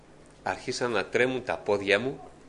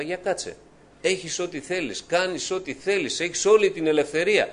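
raised, higher urgent self-questioning; agitated inner questioning; 8 semitones higher, 4 dB louder than usual. Retold or imitated. A lonely man confronting himself, questioning urgently. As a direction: press yourself hard with each question.

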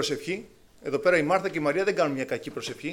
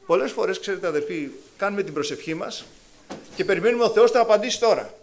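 ordinary talk

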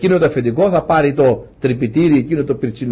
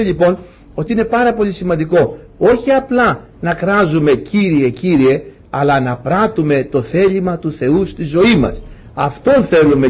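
raised, pleading preacher's prayer to the Lord; fervent prayer; 4 semitones higher than usual. Acted. Preacher to his flock, calling on the Lord in fervent prayer. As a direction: pray it out with rising fervour, higher than the talk.

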